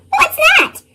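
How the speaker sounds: ordinary delivery, nothing marked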